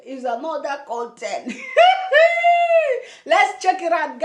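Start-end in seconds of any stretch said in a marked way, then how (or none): 1.6-3.0 a sudden high-pitched burst of laughter. Real, not acted. Vlogger cracks up at her own joke, laughing high. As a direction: laugh out loud, high and bright.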